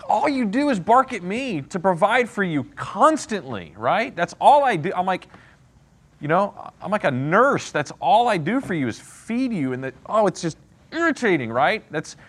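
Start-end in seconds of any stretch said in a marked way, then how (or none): 0.1-9.7 imitating a fed-up leader, pitched higher; frustrated Moses. Teacher voicing Moses griping at the grumbling people, mock-frustrated. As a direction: vent it like a tired provider, half-mocking. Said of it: "you can sense that frustration".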